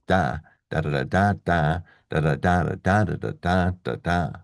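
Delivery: "da" syllables alternating strong beats and quick weak ones; English stress rhythm. Shown as a demonstration. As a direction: beat out sentence rhythm on "da", stressed and unstressed. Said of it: "that's what English sounds like".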